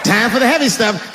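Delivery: usual speaking voice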